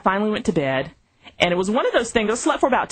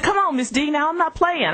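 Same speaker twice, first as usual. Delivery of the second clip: higher, urgent plea of a coworker; pleading young colleague; 11 semitones higher than usual. Retold or imitated. Coworker Terrence begs Miss D not to leave, pleading higher. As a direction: beg her not to go, pitched up.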